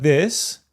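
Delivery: "this" ending on a rise; upward intonation. Shown as a demonstration. Coach demonstrating an upward ending on "this". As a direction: lift "this" up at the end. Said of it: "with an upwards intonation like".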